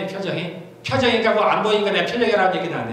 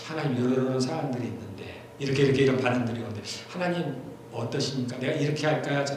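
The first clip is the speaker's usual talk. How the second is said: polite prayer; 7 semitones lower, 7 dB quieter than usual. Shown as a demonstration. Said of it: "정중하게 얘기를 해요".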